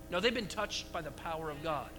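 ordinary talk

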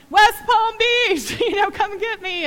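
high, slow, loud giddy reply; giddy answer. Retold or imitated. Mother answering the pilot with giddy relief. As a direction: sing out the city, half laughing.

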